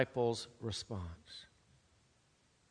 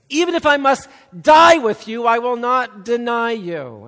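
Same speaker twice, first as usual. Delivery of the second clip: much higher, much louder, last line slowed; loud protest; 9 semitones higher, 17 dB louder than usual. Retold or imitated. Peter vowing to Jesus he'll never deny him, loudly. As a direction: insist on it as a loud vow.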